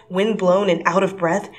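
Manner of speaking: ordinary delivery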